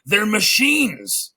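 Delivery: a cartoon hero yelling his big discovery to a friend; loud, high, drawn-out heroic call; excited shout. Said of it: "he just shouts at Ookla".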